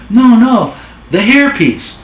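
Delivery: high, loud crooner voice on the phone. Retold or imitated. [name] phoning his lone editor, high, loud crooner impression. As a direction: ham up the famous old singer calling in. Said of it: "That's how I talk, kinda".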